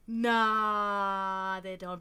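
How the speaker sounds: usual speaking voice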